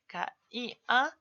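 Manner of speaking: "K I A" spelled letter by letter, slow and even; spelled out slowly. Shown as a demonstration. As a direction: spell out "K I A" carefully, one letter at a time. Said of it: "en vez de".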